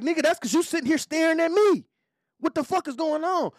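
high, loud retold confrontation; retelling a bar confrontation, mildly heated; mock aggressive retort. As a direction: snap back at him.

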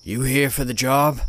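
lightly voiced gruff old man; a hint of a gruff old man on the phone. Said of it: "an old gruff voice came from the other end".

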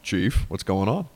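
ordinary delivery

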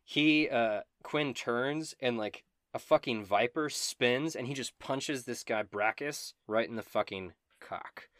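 ordinary talk

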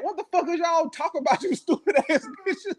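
high, laughing disbelief; incredulous laughing rant. Real, not acted. Ranting at the cast about the coats, high and laughing. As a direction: cackle through the insult.

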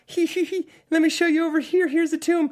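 put-on very high, far-off giggling child voice; spooky ghost kid. Podcaster mimicking the movie's ghost children, high and giggly. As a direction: play a creepy ghost child giggling and beckoning. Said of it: "really fast kids that show up and go".

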